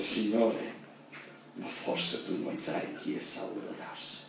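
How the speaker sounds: low, whispered protest; hushed fearful reply. Acted. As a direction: breathe it out, scared of the order. Anania answers God fearfully.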